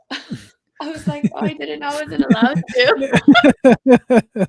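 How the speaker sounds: high, loud, drawn-out through laughter; laughing. Pretend. Host joking back after being teased for coughing, laughing. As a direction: laugh your way through a mock protest.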